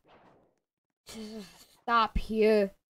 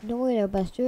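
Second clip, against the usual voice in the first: ordinary delivery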